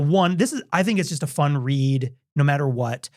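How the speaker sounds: usual speaking voice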